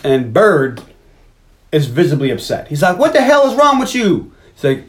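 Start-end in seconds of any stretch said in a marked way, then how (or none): 3.0-4.3 high, loud mock outburst; mock yell. Bird yelling at Tom who walked in front of the bus, high and loud. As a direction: yell it up high, half in jest.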